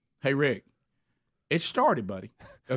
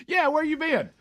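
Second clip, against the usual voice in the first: high-pitched, teasing shout of a question; mock call-out; 13 semitones higher than usual. Airline worker greets the late passenger, high mock call-out. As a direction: send it way up high, mock exasperated.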